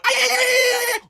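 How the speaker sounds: very high, loud yelping; howling bird screech. Retold or imitated. Mocking the rival's howling, very high screech. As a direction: screech at the top of your lungs. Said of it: "like a howling bird at the top of the lungs".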